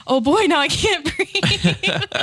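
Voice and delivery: laughing breathless. Retold or imitated. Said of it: "and I'm like".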